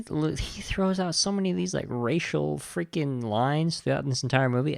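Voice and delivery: ordinary delivery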